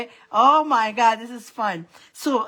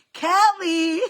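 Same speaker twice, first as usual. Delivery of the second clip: excited call; 7 semitones higher than usual. Real, not acted. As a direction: call out to a friend you just spotted. Recognising a viewer by name.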